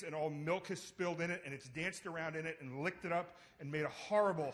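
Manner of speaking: normal talking voice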